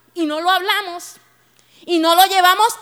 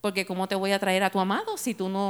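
gentle voice of God; 7 semitones lower, 8 dB quieter than usual. Retold or imitated. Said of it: "el Señor decía".

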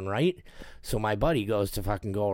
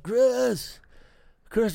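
brother calling out; 12 semitones higher, 4 dB louder than usual. Retold or imitated. Injured brother calling him.

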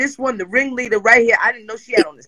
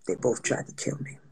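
very low, near-whispered admission; hushed confession; 12 semitones lower, 14 dB quieter than usual. Real, not acted. Telling friends about two Gemini exes, hushed. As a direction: drop it to a barely voiced undertone.

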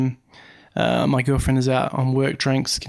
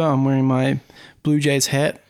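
ordinary delivery

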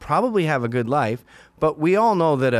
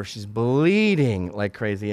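normal talking voice

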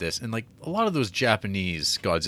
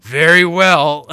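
slow, booming hammy pronouncement; Charles Dance; 5 semitones higher, 13 dB louder, much slower than usual. Retold or imitated. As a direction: proclaim it loudly, grandly drawn out. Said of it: "Charles Dance shows up".

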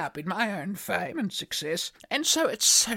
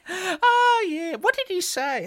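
ordinary talk, nothing marked

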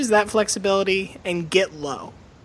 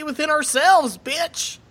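higher mock-tough Southern counseling; shit-kicking grief counselor; 7 semitones higher, slower than usual. Grief counselor finishing his point, shit-kicking Southern voice. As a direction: land the therapy talk like a comic threat. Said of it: "doesn't work if you're a grief counselor".

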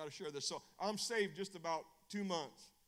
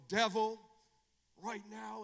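commanding prayer; 6 semitones higher, 9 dB louder, slower than usual. Retold or imitated. Retold commanding prayer against devil.

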